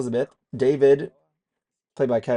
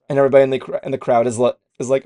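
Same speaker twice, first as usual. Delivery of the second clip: normal talking voice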